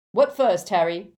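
loud call across the room; a loud eager call. A narrator's reading. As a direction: shout it to Harry over the crowd. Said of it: "called George".

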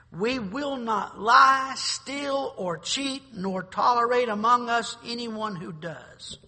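proclaimed creed. Acted. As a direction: ring out each word of the oath. Reciting the honor code to youth.

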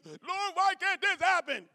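a hint of a high whiny plea; lightly voiced frustrated prayer; mock complaining believer. Pastor voicing a believer to church, mild whiny plea. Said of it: "You think God is doing something".

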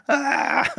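high cry of pain; pained shout. Retold or imitated. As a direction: yelp like a bone just broke.

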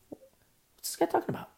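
very high, squeaky puzzlement; baffled bystander. Acted. Bystander to companion about the man yelling below, baffled. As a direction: wonder aloud in a small high voice.

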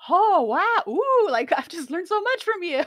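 high, loud gushing awe. Retold or imitated. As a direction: gush like a starstruck fan. Imitating gushing at guests.

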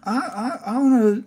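high-pitched wide-eyed pleading; earnest Harry character. Acted.

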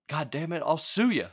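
half-hearted imitation of a boss blowing up; playful mock rage at the coach; mock angry outburst. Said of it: "Jerry goes".

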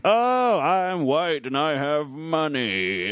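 high, slow mock boasting. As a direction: sing out a hammed-up boast about your wealth. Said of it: "and then just being like".

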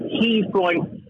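usual speaking voice

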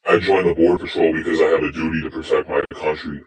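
ordinary talk

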